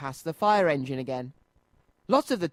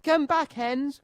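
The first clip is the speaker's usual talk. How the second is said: a storybook driver calling after his escaped hens, calling out; 9 semitones higher, slower than usual. A narrator's reading. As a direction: call after them down the street. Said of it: "cried Noddy".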